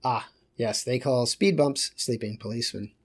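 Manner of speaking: ordinary delivery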